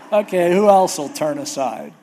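loud, exasperated call; mock God fed up. God calling for a replacement, loud and exasperated. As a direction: bellow it like a fed-up boss. Preacher as God after toasting Moses.

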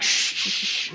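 urgent repeated shushing; imitated hushing of a crying child; voiceless "shh" to quiet someone. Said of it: "I finally calm him down".

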